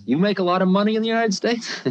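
high playful question with a laugh in it; sly old guru fishing for money; guru's teasing voice. Retold or imitated. Guru asking about American wealth, high, teasing and laughing. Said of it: "he says".

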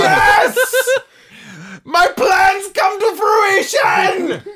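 maniacal laugh and slow triumphant roar; villain shout. Pretend. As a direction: gloat like a cartoon supervillain.

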